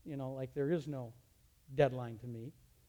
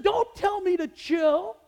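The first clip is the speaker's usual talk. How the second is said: high, loud, slow stern command; angry warning; 16 dB louder, slower than usual. Retold or imitated. Teacher warning the student, loud slow angry command. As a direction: lay down the law, slowly.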